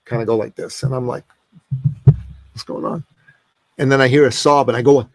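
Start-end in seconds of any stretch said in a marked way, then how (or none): 2.5-3.0 whispered, puzzled aside to himself; hushed wondering. Retold or imitated. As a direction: whisper it under your breath, baffled. Puzzled aside at her shaking shoulders.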